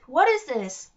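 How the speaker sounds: loud alarmed shout; yelled. Acted. Alex dangling in the trap, shouting. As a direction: demand it in a panic. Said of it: "Alex yelled".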